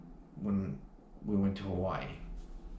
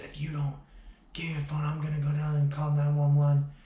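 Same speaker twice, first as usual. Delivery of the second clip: half-whispered menace rising to a loud threat, higher; menacing threat; 7 semitones higher, 6 dB louder than usual. Retold or imitated. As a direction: mimic her evil, demonic warning. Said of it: "it looked like a demon".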